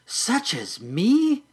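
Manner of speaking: high, slow amazed question; in wonder. Acted. Patty amazed she is like a caterpillar, high and slow. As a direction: marvel at the idea. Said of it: "Patty asked in wonder".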